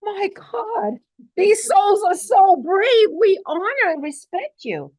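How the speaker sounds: awed ascended masters. Retold or imitated. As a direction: voice the masters in tearful awe. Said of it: "the ascended masters always say".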